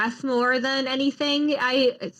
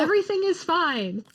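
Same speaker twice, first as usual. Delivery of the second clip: mock reassurance; 7 semitones higher than usual.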